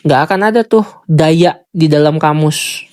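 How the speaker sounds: ordinary delivery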